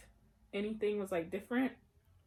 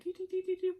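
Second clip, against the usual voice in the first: light, high doo-doo running noise; imitated scampering up steps; high sing-song doo-doo; 10 semitones higher, much faster than usual. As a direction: patter out high little doos. Mimics running up little steps.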